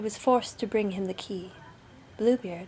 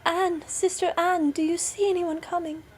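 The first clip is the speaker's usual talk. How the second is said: afflicted wife crying out; 8 semitones higher, 3 dB louder than usual. A narrator's reading. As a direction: call up to the tower anxiously. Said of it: "afflicted wife cried out from time to time".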